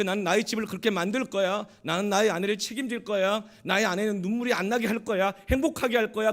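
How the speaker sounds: loud, high, emphatic resolve; impassioned vow. Retold or imitated. Young man vows to protect his future family, loud determination. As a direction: proclaim each promise forcefully. Recalled vow, forcefully acted.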